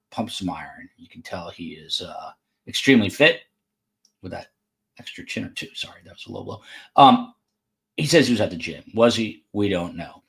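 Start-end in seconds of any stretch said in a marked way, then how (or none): 4.2-6.6 dropped to a whisper, sheepish; a quick, guilty whispered jab; whispered aside. Real, not acted. Host mocking Sam's chin under breath, whispered aside.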